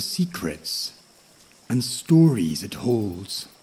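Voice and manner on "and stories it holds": ordinary delivery, nothing marked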